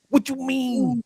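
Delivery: playful mock of Brooklyn emphasis; imitating Brooklyn lady. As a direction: lean hard on every word like a Brooklyn woman. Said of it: "they feeling everything they be saying".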